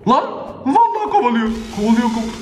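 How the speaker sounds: high panicked cry; being chased. Real, not acted. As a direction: yelp as if something is after you. Pizza man chasing him. (kovalanıyor, panik içinde)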